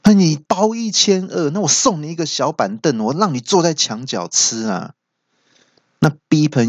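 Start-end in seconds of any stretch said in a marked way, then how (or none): 0.0-4.9 high-pitched mock indignation; angry groom. Groom answers friend's stingy gift, angry. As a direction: snap back high, acting offended. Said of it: "听到就很生气".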